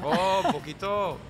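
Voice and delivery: high-pitched put-on French accent; slow, high mock French accent. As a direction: play at French while speaking Spanish. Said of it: "yo también hablo francés".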